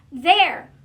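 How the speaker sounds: triumphant loud shout. Acted. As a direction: bark it out proud from the top of the pile. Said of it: "shouted Pig".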